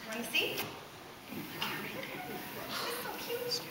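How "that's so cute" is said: soft sing-song coo. Real, not acted. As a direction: coo it to the little one. Cooing at baby over picture.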